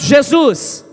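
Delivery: called out loud; shout. Shown as a demonstration. Preacher showing the congregation how, loud call. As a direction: shout the name for the church. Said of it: "Grita bem alto".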